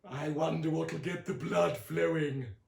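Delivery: slow, coy hinting; Aunt Summer winks at Sonny, slow coy hint; sly aunt. A narrator's reading.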